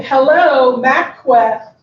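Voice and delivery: loud, sassy mock phone greeting; sassy comeback. Employee answering the mentor's call for directions, sassy and loud. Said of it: "I wasn't scared being sassy back".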